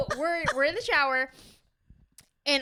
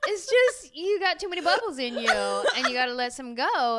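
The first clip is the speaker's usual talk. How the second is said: higher, laughing, playful excuse voice; laughing mock excuse, higher pitch; 8 semitones higher, 3 dB louder than usual. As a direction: act out a sheepish excuse while laughing. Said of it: "And then you're like".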